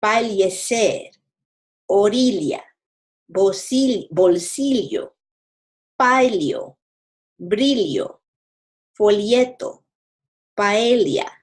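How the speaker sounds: ll in Fallecer, orilla, bolsillo said as l plus y; ly sound for ll. Shown as a demonstration. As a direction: ll as ly, old Castilian style. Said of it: "Now the L sound".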